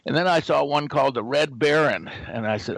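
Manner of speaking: normal talking voice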